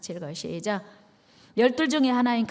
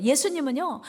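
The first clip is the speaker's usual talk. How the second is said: normal talking voice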